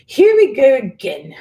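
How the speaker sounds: high, loud scoff; scoffing witch. A narrator's reading. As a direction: roll it off, fed up. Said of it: "she scoffed".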